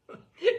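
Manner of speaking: short high nervous laugh; a guilty little laugh to cover up. Acted. Host laughs nervously to hide the locked room.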